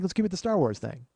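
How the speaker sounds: ordinary talk, nothing marked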